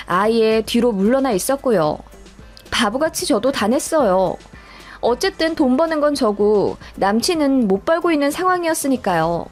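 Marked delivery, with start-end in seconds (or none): none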